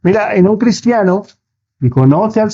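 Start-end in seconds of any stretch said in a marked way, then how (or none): none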